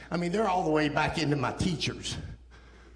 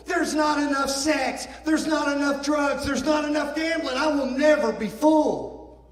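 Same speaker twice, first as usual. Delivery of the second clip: impassioned cry; 10 semitones higher, 4 dB louder than usual. Retold or imitated. Crying out his despair.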